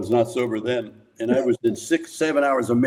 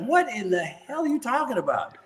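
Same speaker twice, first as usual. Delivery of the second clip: baffled outburst; 10 semitones higher, 4 dB quieter than usual. Retold or imitated. Baffled reply to studio.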